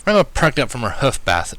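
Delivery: ordinary delivery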